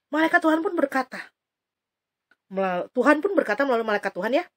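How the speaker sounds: ordinary delivery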